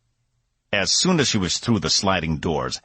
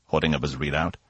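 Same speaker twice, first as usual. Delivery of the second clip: ordinary delivery, nothing marked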